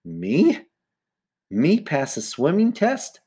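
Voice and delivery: high, rising disbelief, drawn out; incredulous. Acted. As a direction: question it slowly, voice climbing in surprise. Sheila cannot believe it.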